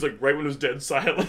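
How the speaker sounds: ordinary delivery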